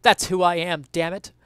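normal talking voice

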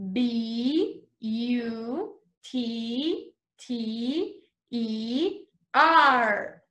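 each letter slowly and apart, very slow; slow spelling. Shown as a demonstration. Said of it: "Let's spell butter".

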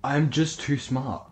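ordinary delivery

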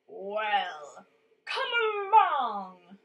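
big, booming goat challenge; loud, drawn-out call to come on; crying out boldly. A narrator's reading. Third billy goat daring the troll, loud and bold. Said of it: "cried the third billy goat".

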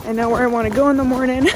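talking while bouncing on a rough road; on a bumpy road. Real, not acted. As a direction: voice jolting over bumps. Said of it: "it's so bumpy".

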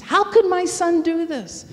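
a mother's heartbroken plea; anguished cry to God. Retold or imitated. Mother cries out to God over her son, anguished. Distraught mother praying aloud.

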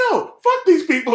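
furious refusal to close the door; retold angry retort. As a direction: snap back at his partner. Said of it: "And I went off".

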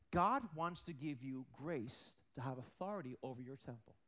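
usual speaking voice

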